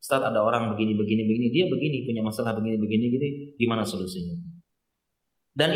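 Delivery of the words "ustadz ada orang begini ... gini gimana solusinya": calm, composed. Retold or imitated. Asking the ustadz about someone else, calm and softer. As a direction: lower and softer, steady asking. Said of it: "dia tenang, tenang".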